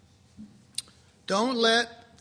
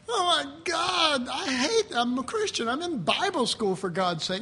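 mock distress; 5 semitones higher than usual. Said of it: "I'd wake up and go".

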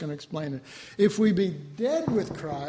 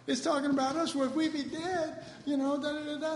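higher, hushed mock discovery; mock surprised reader; 8 semitones higher, 5 dB quieter than usual. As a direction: play a puzzled Bible reader finding himself. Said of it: "You go".

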